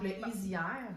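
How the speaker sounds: ordinary delivery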